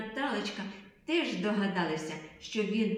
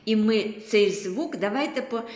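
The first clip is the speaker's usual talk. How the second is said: normal talking voice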